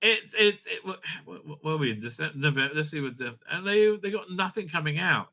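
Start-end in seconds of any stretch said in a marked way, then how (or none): none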